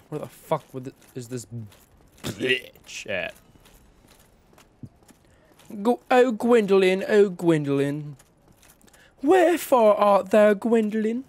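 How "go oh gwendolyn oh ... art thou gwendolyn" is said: high, loud Shakespearean lament; hammed-up lover calling for a beloved; mock theatrical calling. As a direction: call out high and grandly, playfully. Mock Romeo calling for Gwendolyn.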